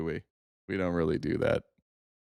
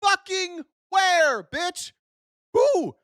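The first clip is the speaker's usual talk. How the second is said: high-pitched incredulous outburst; exasperated challenge; 9 semitones higher, much slower than usual. Real, not acted. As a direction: demand it in a high, drawn-out cry. Angrily challenges the clip's claim.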